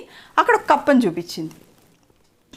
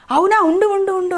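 eager butterfly; 7 semitones higher, 4 dB louder than usual. Acted.

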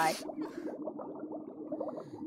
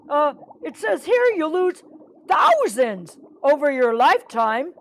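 hesitant, higher fish voice; nervous answer; 5 semitones higher than usual. Acted. Birdie reads the shark a fact, higher nervous voice. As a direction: offer the fact timidly, pitched up.

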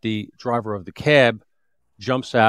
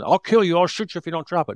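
panicky rookie; 7 semitones higher than usual. Retold or imitated. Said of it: "rookie that was gonna stand there shaking his gun".

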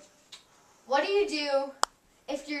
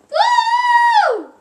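cheer; 13 dB louder than usual. Real, not acted. Cheering after her song.

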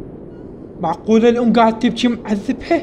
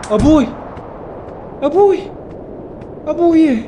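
calling out; 8 semitones higher than usual. Real, not acted. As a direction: call out for dad across the room. Calling out to his father.

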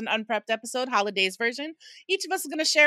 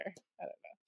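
whispered I don't know; whispered aside; 21 dB quieter, slower than usual. Real, not acted. Wondering about uniform cleaning, a whispered shrug. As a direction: breathe it out, almost unvoiced.

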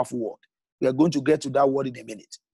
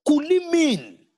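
much higher and louder, slow and drawn out; searching question; 12 semitones higher, 5 dB louder, slower than usual. Acted. Preacher posing a big question to the congregation, high slow searching call. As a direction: soar up on the question, then hold.

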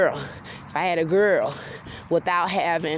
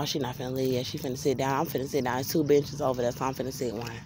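quiet aside; 4 semitones lower, 6 dB quieter, faster than usual. Real, not acted. Aside about lady on bench.

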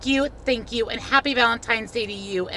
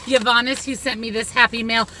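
ordinary delivery

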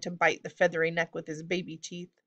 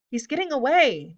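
higher-pitched cry of alarm; urgent, raised-pitch warning; alarmed call; 7 semitones higher, 4 dB louder than usual. A narrator's reading. Alarm as the goblin escapes.

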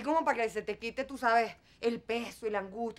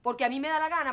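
louder, higher, hammering insistence; defiant repeat; 3 semitones higher, 5 dB louder, faster than usual. Acted. Mother repeats her decision to her daughter, defiant and loud. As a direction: hammer home the words me da la gana.

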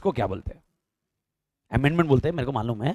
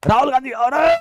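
calling out to someone, louder and higher; teacher playfully shouting a politician's name; mock call out; 7 semitones higher, 6 dB louder than usual. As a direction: hail him across a crowd, half joking.

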